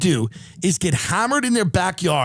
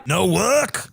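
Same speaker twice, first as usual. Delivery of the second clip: put-on Australian accent. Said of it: "I try to do an Australian".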